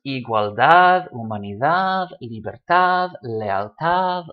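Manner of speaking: igualdad, humanidad, libertad, lealtad with the final DAD or TAD hit hard; stress on last syllable. Shown as a demonstration. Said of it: "all stressed on the last syllable".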